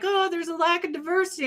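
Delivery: high-pitched mock whine of objection; mock complaint. Mock complaint she didn't make.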